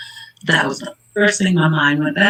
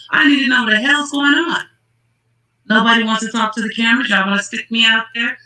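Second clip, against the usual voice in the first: dad demanding answers; 6 semitones higher than usual. Retold or imitated. Voicing dad demanding answers, much higher.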